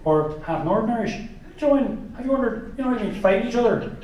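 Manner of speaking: a lightly voiced, meandering Irish comic, laughing; Jimeoin impression. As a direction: ramble like a confused Irish comic. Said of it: "Jamoan could run Ireland, you know, and be like".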